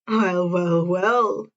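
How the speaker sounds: a hint of a smug pro hero; low, amused well-well-well; lightly voiced Hawks. Hawks interrupts the pair.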